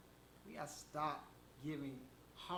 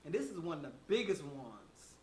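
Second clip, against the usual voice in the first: normal talking voice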